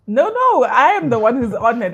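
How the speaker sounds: loud, high no no, I'm the honored one; bright emphatic denial; emphatic protest. Real, not acted. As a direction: insist warmly and loudly. Host insists she is honored.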